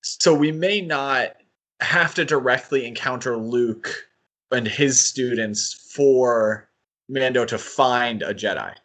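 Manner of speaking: normal talking voice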